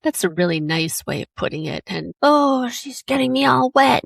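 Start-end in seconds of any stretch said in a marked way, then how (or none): 2.2-4.0 higher, mildly put-on grumble of an angry man; a touch of hammed-up anger; mock angry complaint. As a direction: imitate his whiny, annoyed moan. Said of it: "just so angry".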